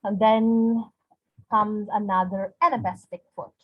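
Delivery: normal talking voice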